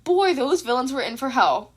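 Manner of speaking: usual speaking voice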